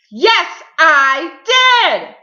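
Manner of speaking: very loud, high holler; top-of-voice shout. A narrator's reading. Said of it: "shouted Mister Noisy at the very top".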